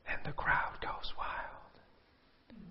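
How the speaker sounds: slow, breathless whisper of awe; mock whisper. Shown as a demonstration. Preacher mocking the applause, slow sarcastic whisper. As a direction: hush it like a sarcastic secret.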